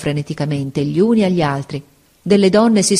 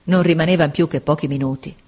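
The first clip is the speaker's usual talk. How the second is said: plain reading voice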